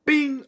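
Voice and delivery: high, loud bing for the hit; mimicked impact with gusto; punchy sound effect; loud punch sound.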